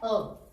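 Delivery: "अ" as a short schwa; article as "uh". Shown as a demonstration. Teacher confirming the right pronunciation, "अ" said as uh. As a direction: say "अ" as a brief uh. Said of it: "ये इसकी right pronunciation है".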